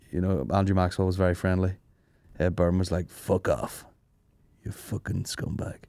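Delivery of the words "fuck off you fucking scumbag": imitating a surly brush-off, soft and slow; retold curt dismissal. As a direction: mutter the insult under your breath. Retold unfriendly comedian brushing him off.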